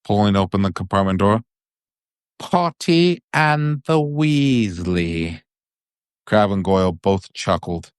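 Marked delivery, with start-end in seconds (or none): 2.4-5.4 sneering Malfoy. A narrator's reading. Malfoy taunting Harry and Ron.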